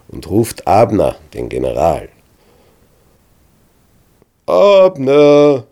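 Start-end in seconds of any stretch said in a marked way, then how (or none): 4.5-5.7 higher, louder call across the valley; shouting. A narrator's reading. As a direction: yell his name with all you have. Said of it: "brüllt David so laut er kann".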